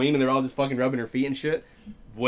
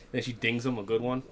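ordinary talk

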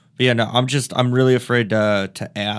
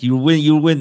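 loud, higher mock Lebanese accent; bad Lebanese accent; 4 semitones higher, 7 dB louder than usual. Booker answering a comic, badly faked Lebanese accent. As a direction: badly imitate a Lebanese speaker, raised voice. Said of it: "This is a really bad Lebanese accent".

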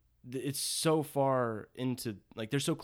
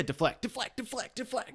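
mocking; 11 semitones higher than usual. Mocking her dodging, repeated.

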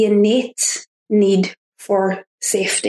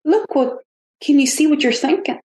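higher, gently wondering questions; curious inner observer, pitched up; wise elder voice; 6 semitones higher than usual. Acted. Speaker voices an awareness watching her thoughts, wise elder voice. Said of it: "kind of like this wise kind of elder".

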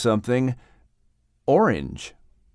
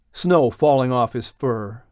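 usual speaking voice

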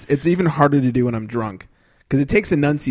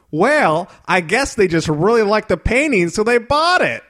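Huell Hauser explaining; 6 semitones higher, slower than usual. Retold or imitated. Host relaying the owners to viewers, high and earnest. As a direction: announce it as great news. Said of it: "my really bad Huell Hauser impression".

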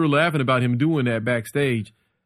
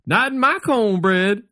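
high, drawn-out punchline; Adam's catchphrase; 8 semitones higher, slower than usual. Retold or imitated. Storyteller voices Adam pitching the bit backstage, high and slow. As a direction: stretch it out as a comic's catchphrase. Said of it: "I'd just be like".

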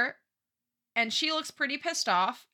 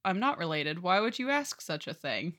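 lower, softer mock-courtly reply; a hint of a suave lord playing innocent; lightly voiced nobleman; 4 semitones lower, 5 dB quieter than usual. [name] answers Lady Kincaid, mock-courtly.

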